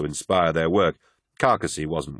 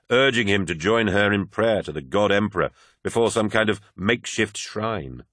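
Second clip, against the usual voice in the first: usual speaking voice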